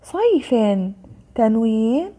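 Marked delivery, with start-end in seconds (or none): 0.0-0.5 صيف read high with an added -in ending; tanween kasr. Shown as a demonstration. Teacher reading out the word list, tanween kasr on صيف. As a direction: sound out صيف as "sayfin". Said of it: "كسر أيضًا".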